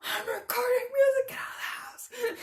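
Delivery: high, loud mock shriek of a busy mother; imitating mom yelling. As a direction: shriek it like mom chasing kids out.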